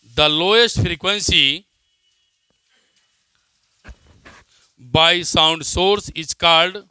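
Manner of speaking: slow, deliberate dictation pace. Acted. Teacher dictating a definition to students, slow dictation. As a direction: spell it out word by word for note-taking.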